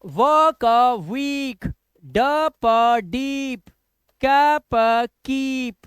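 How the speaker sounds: "व का वीक, ड प डीप, क प कीप" sounded out piece by piece, very slowly; drawn-out sounding out. Shown as a demonstration. Teacher drilling a memory aid for students, slow and drawn out. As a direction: stretch the long ee in "वीक", "डीप", "कीप", loudly. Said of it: "इस तरह से आप इसको याद करेंगे".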